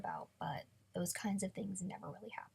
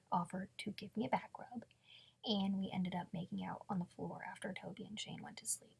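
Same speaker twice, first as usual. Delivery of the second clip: ordinary talk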